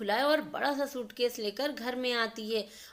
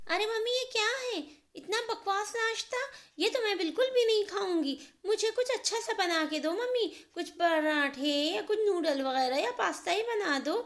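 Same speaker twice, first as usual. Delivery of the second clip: much higher, fast whiny brat; spoilt complaining daughter; 9 semitones higher than usual. Acted. Neha rejecting her mother's breakfast, high and whiny. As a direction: sneer at the food and demand better.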